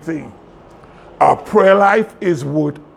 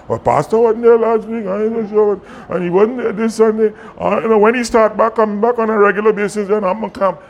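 higher, voiced mock murmuring of excuses; devil's whispered doubts; 6 semitones higher than usual. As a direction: play a doubting churchgoer, pitched up. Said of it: "the devil is gonna whisper them".

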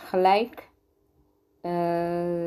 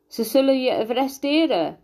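higher-pitched exclamation; moderately raised, high cry; called out; 6 semitones higher than usual. A narrator's reading. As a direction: call it out as a threat, pitch up. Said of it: "riep Vico".

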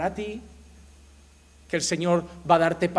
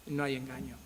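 soft echo; 4 semitones lower, 10 dB quieter than usual. Real, not acted. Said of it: "repeated softly".

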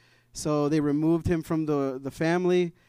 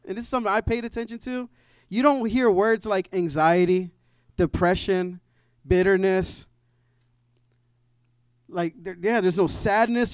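raised, emphatic pulpit voice, pushing on key words; fervent preaching; 4 semitones higher, 4 dB louder than usual. Acted. Pastor urging his church to trust the foundation, fervent preaching. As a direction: preach it out to the congregation, building conviction.

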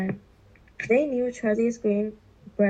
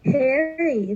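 louder, higher call; cried out; 4 semitones higher, 5 dB louder than usual. A narrator's reading. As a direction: call out to the dog. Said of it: "they cried".

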